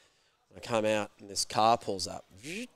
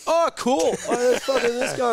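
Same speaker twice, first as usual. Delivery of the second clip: high, loud bar-room cheer; re-enacted rowdy friends' reply; oblivious mates shouting; 8 dB louder than usual. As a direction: call out cheerily over loud music. Acted mates over loud bar music.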